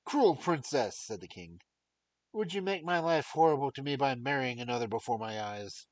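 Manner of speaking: loud wounded king; king's reproach. Acted. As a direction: reproach her in despair.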